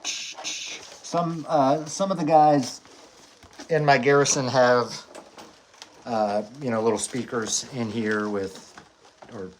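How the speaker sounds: muffled inside a helmet; speaking through a helmet shell. Real, not acted. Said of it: "I don't know how well you can hear me".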